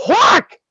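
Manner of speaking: high, loud whack sound effect; loud punchy whack noise; imitated whack. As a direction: smack the word out like the hit landing. Sound of the chop.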